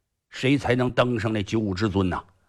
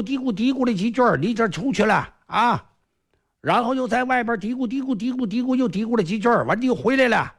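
higher, eager younger-brother voice pressing for answers; second hero pressing; 9 semitones higher than usual. Acted. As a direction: needle big brother to just spill it, voice raised high. Second hero questions elder brother.

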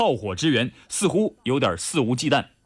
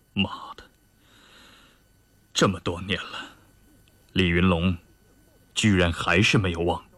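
musing mutter; 10 semitones lower than usual. Acted. Chu Yunfei to himself, remembering Li Yunlong after many years, low musing mutter. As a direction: mutter it very low, starting in a whispered curse. Officer muses on old memory.

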